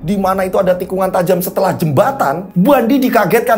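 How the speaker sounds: high-pitched, suspenseful storytelling; dramatic ghost-story narration. A narrator's reading. Storyteller recounts Bandi's night drive, dramatic ghost-story narration. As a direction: drive the horror tale along.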